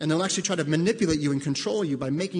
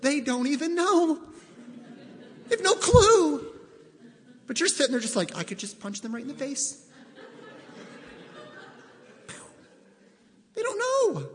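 much higher, amused, with a laugh; amused disbelief; 8 semitones higher than usual. Real, not acted. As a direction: laugh at how clueless they are. Laughing at unaware offender.

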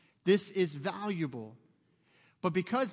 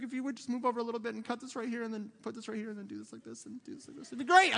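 rushed nitpicking; 4 semitones higher, faster than usual. Retold or imitated. Controlling dad correcting son.

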